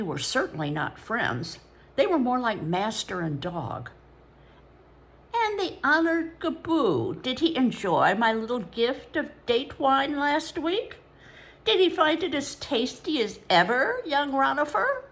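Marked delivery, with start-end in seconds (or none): 5.3-15.0 higher, oily servile voice; high ingratiating voice with an accent; fawning Babylonian porter. A narrator's reading. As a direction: grovel sweetly while prying. Ibni prying about the gift.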